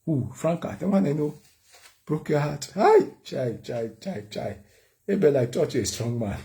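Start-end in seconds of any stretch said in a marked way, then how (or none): 2.7-3.1 very high, loud shocked yelp; shocked cry. Retold or imitated. Mom hearing son's heartbreak, high shocked yelp. 3.2-4.6 lamenting chai. Retold or imitated. Mother lamenting.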